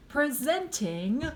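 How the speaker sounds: high, drawn-out showman's call; slow ringmaster-style yell, falling at the end; yelled announcement. A narrator's reading. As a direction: announce it grandly, stretching every word. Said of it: "yelled Martin".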